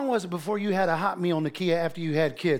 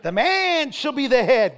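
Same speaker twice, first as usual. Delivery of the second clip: slow preachy lecture; 3 semitones higher, 3 dB louder, slower than usual. Retold or imitated. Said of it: "she's like".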